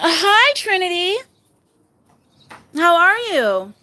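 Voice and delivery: high, sing-out hello; affectionate greeting. Real, not acted. Aunt greeting her niece, high affectionate hello.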